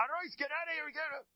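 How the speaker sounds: very high, urgent yell; acted outraged shout. Rabbi driving the whispering gabbai away, acted outraged shout. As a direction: chase him off in staged fury.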